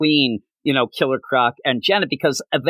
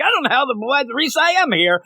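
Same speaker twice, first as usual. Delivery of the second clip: higher, puzzled Lobo voice; mock confused Lobo; 10 semitones higher, faster than usual. Lobo wondering why he's in the book, high and puzzled. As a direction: sound lost and high.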